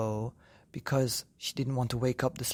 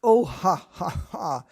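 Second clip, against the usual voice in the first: mock laughter; 5 semitones higher, 6 dB louder, slower than usual. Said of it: "the wife started to laugh".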